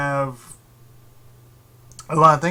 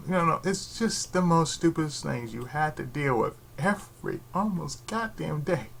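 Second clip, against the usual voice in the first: normal talking voice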